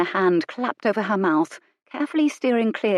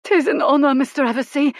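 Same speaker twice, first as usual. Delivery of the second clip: high, loud, adoring footman voice; melting admiration; 8 semitones higher, 5 dB louder than usual. A narrator's reading. Footman bowing to Colin, high and adoring. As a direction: gush it with starstruck admiration. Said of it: "His voice was all melting admiration".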